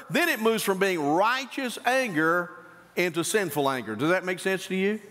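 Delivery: normal talking voice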